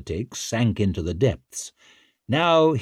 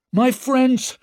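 high, loud public address; raised orator's cry; rallying shout; 5 dB louder than usual. A narrator's reading. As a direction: call the chamber to your side. Leo addressing the angry chamber.

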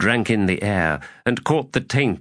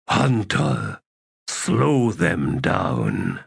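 slow, drawn-out creature voice, dropping lower; reptilian commander; 5 semitones lower, much slower than usual. A narrator's reading. Sag Chorok sends the hunter off, slow creature command. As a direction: draw the order out slowly, sinking in pitch.

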